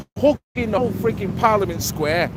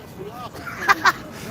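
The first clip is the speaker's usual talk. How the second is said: breathless laugh; 13 semitones higher, 5 dB quieter than usual. Real, not acted. Laughs at the Nazi steak joke.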